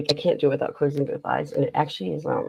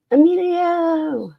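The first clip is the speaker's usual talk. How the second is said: movie quote; 14 semitones higher, 5 dB louder, much slower than usual. Retold or imitated. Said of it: "And I was like".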